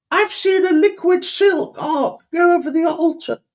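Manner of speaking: very high, loud Cockney squeal; mock Cockney urchin. Urchin answering his governor while polishing, squealed Cockney. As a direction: squeak back eagerly as a Cockney servant. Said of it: "I heard your Cockney accent".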